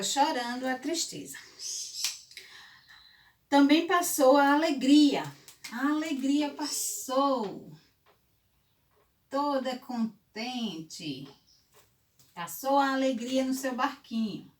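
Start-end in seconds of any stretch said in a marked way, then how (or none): 3.5-14.5 put-on storytelling to children. A narrator's reading. Fable narration, joy passes.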